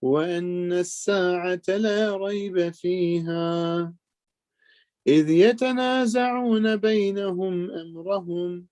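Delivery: melodic chanted recitation of the verse; Quran recitation. Acted. Teacher reciting the verse to his listeners, melodic chant. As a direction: intone the verse in tajweed style.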